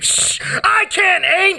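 high strained cry. Acted. High strained line in poem.

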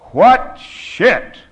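high, very loud exclamation; loud outburst. A narrator's reading. Narrator exclaims after swishing the wine, loud outburst. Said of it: "My voice is loud in the doorway".

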